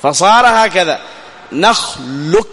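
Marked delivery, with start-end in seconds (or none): 1.5-2.5 نخلق with no qaf heard, straight into a doubled kaf; teacher demonstrating the qaf is gone; qaf dropped. Shown as a demonstration. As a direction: show the qaf disappearing. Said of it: "ذهب القاف ما تسمعه".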